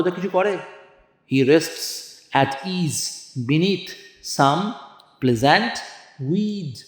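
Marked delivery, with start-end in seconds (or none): 1.3-6.7 slow, drawn-out recitation of verse; slow poetry reading. Shown as a demonstration. Teacher reading Keats to the class, slow and measured. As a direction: read the line slowly, savouring each word.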